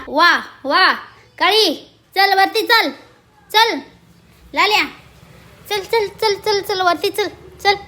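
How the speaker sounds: loud, high calls of encouragement; urging on. Real, not acted. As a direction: cheer the climber upward, high and loud. Urging cats in climbing race.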